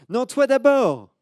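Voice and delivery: put-on high, loud, slow child's retort; loud high you first; little girl insisting.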